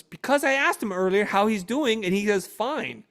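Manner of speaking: high, loud, indignant explanation; fuming wife. Retold or imitated. Fuming wife explaining to husband why she is upset, angry outburst. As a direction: imitate a wife letting off steam. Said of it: "she was fuming".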